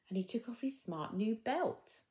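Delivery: usual speaking voice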